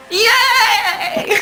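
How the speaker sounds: very high, drawn-out squeal; fangirl cheer. Retold or imitated. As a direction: cheer like a giddy viewer. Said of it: "And you're like".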